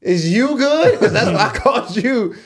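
high, loud, laughing comeback; incredulous laughing retort. Retold or imitated. Son laughing back at his mom on the phone, high-pitched incredulity.

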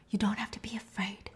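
calm her fear in a hush; whispered reassurance. Retold or imitated. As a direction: therapist whispering to a paranoid patient. Therapist calming frightened patient.

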